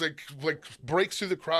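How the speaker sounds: ordinary talk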